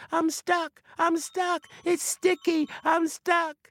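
high, plaintive spirit caught fast; high little voice of a stuck forest spirit; trapped spirit voice. A narrator's reading. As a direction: complain high and thin, then threaten. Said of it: "said the voice".

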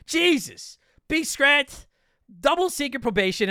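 high-pitched, exasperated mock reprimand; mock scolding. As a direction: hand down a punishment in playful outrage.

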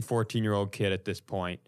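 ordinary delivery

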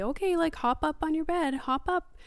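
put-on high, encouraging toddler-directed talk; a hint of chirpy parent voice; mom to child. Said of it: "I say".

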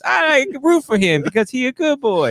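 high silly voice; gushing commenter. Acted. Online commenter backing the good boy, high hammed-up gushing. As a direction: cheer for him in a high voice. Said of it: "the comments will be endless".

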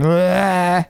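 high wordless bleat, drawn out; imitated sleep noise. Teasing partner about nightly sleep paralysis, high mock groan. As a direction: mimic a silly "bleh" in a high voice. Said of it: "you're just like".

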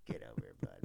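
low, unvoiced whisper of a clingy dad; mock whispered dad. Dad teasing his grown kid in a whisper. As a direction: hush it like an embarrassing dad. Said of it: "the weird dad who's like".

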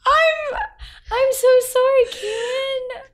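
high, crying voice with real sorrow; sob-tinged sympathy, words stretched out; tearful condolence. Real, not acted. Learning a friend's dog died, tearful condolence.

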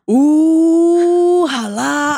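loud, high gasping ooh; scandalized gasp. Retold or imitated. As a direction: tattletale kid catching you out.

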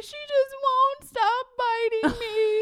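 put-on high, loud wailing little-boy voice; imitating crying child. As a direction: wail it like an upset kid demanding a refund. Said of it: "he's crying".

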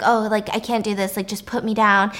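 usual speaking voice

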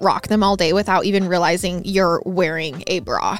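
upbeat, loud sponsor read. Acted. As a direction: sell it like a hosted podcast ad.

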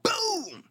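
shouted high boom for the kick; boom sound effect. Retold or imitated. Friends hear the kick land: high shouted boom. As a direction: punch the boom like an impact noise.

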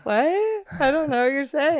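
high, feigned ignorance; mock innocent. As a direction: play dumb, teasing. Teased about attraction, feigns ignorance.

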